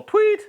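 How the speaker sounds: high, sharp vocal "tweet" like a whistle blast; quick shrill-free whistle chirp; whistle imitation. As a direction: mimic the coach's whistle with your voice. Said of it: "Blow the whistle".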